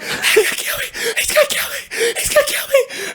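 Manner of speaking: put-on high, sobbing child's wail, near whispered; crying panic. To older brother after the scare, sobbing wail. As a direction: sob it out terrified.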